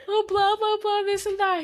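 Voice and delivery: high, hammed-up blubbering; fake-cry through the complaint; mock sobbing. Wife retells crying to husband, high mock sobbing. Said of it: "And I'm like".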